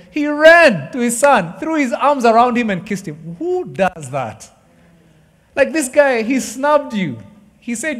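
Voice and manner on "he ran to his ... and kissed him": rising excitement. Acted. Preacher reading father's embrace.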